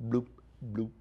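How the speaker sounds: soft single bloops, long gaps between; very slow bubbling. Shown as a demonstration. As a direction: imitate a lazy simmer, one bubble at a time. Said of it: "very slowly".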